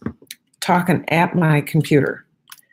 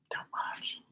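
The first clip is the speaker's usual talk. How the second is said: whispering; 19 dB quieter than usual. Real, not acted. Joking aside, hushed.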